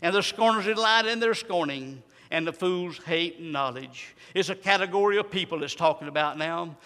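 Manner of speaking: low, measured verse reading; subdued scripture preaching. Real, not acted. Preacher explaining the simple ones' verse, low subdued preaching.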